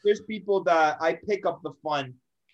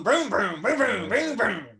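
engine revving noises; 4 semitones higher, slower than usual. Pretend. Buddy mimics two bikes revving in the shop, vroom noises. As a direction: mouth vroom of a revving bike.

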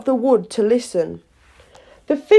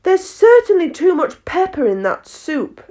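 put-on high girlish voice; 8 semitones higher, 4 dB louder than usual. A narrator's reading. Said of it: "Alice said to herself".

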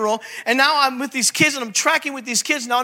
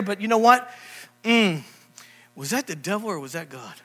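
doubting churchgoer; 5 semitones lower than usual. Retold or imitated. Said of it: "How many of you heard that?".

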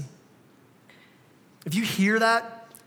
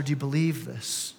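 quiet earnest question; 7 semitones lower, 6 dB quieter than usual. Acted. Intimate quoted question.

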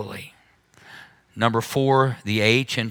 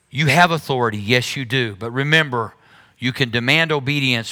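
ordinary talk, nothing marked

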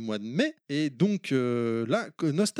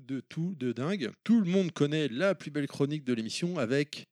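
usual speaking voice

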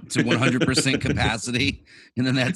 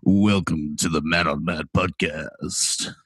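low, slow just-woke-up voice; sexy morning voice; 5 semitones lower, slower than usual. Real, not acted. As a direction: drag it out low and sleepy, lightly sultry. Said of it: "sounds of my sexy morning voice".

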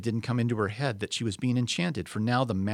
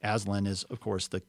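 plain reading voice

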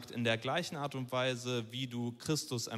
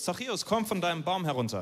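a raised, brisk call to someone up high; 6 semitones higher, faster than usual. Retold or imitated. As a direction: call him down from the tree, quickly. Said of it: "Und er sagt zu Zachäus".

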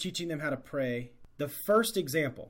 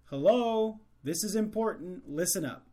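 attention-grabbing call; 5 semitones higher than usual. Acted. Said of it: "it should be like".

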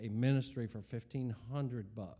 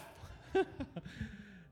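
laugh; 8 semitones higher, 3 dB quieter than usual. Real, not acted. Laughs after mock scolding.

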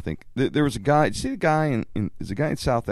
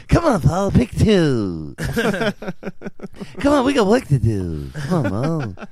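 Larry voice; 4 semitones higher than usual. Retold or imitated. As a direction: nag like a Stooge. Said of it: "I would have been like Larry".

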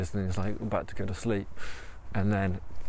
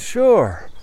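encouraging tiger; 11 semitones higher, 8 dB louder than usual. Acted. Tiger answers runner brightly.

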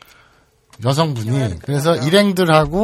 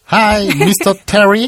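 put-on Korean accent English; 6 semitones higher, 6 dB louder, much slower than usual. Host hams English greeting.

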